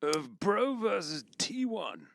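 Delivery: put-on high, reedy old man voice; a geriatric professor opening the show. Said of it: "I'm not judging your old man voice".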